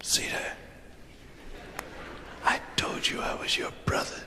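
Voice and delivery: hushed whisper in the dark. Acted. Brothers whisper in bed after lights out. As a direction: whisper so dad can't hear.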